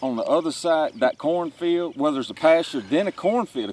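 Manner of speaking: excited. Real, not acted. Excitedly retracing the turkey's route.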